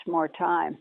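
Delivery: usual speaking voice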